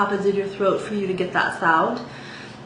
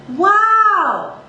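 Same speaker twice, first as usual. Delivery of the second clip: "Wow" high and louder, resonating in the mouth; chest-voice wow; 11 semitones higher, 5 dB louder than usual. Shown as a demonstration. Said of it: "feel how it reverberates out of the mouth".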